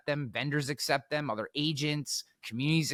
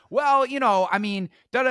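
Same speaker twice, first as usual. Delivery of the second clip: high, louder imitated excuse-making; mock flustered backpedalling; 9 semitones higher, 6 dB louder than usual. Cornered heckler making excuses to speaker, mock fluster. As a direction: stumble into placeholder syllables, mockingly.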